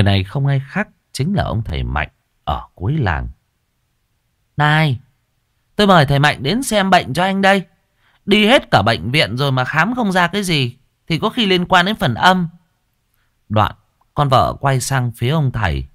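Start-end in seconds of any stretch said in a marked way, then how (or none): 4.6-12.5 put-on higher, quicker young wife voice; high fast wife voice; wife speaking. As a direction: briskly tell the husband who you've brought. Wife explains to husband.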